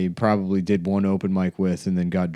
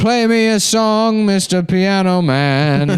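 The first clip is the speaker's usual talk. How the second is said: put-on high, slow, pleading old man; sing-song plea to the piano man; 9 semitones higher, 4 dB louder, slower than usual.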